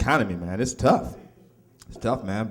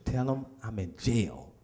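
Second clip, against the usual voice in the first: hushed instruction; 5 semitones lower, 14 dB quieter, slower than usual. Retold or imitated. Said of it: "I said".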